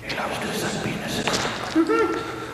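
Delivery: slow high whisper. Pretend. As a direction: hiss it as a joke aside. Whispered heckle.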